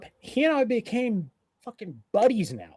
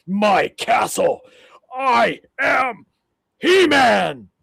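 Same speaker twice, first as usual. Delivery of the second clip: booming, slow, hammed-up hero shout; mock He-Man shout; 5 semitones higher, 12 dB louder, much slower than usual. Defending his house from the guy, mock He-Man shout. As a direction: bellow it like a cartoon hero claiming his castle.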